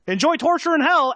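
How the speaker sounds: damn them with cheerful cruelty; gleeful sendoff. Acted. Mephisto dooming Allie, high gleeful mock farewell. Demon lord dooms a player.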